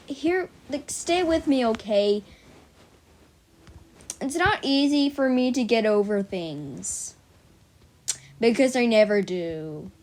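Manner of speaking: ordinary delivery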